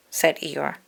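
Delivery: usual speaking voice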